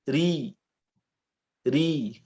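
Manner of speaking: ri light and thin. Shown as a demonstration. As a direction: keep ri thin. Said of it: "ro tipis ini dalam keadaan kasroh".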